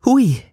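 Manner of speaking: high, loud yelp of a scalded mouth; burnt-mouth hui. A narrator's reading. Nun burns her mouth on the sausage, high yelp. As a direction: yelp at the too-hot sausage. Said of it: "wedelte mit der Hand".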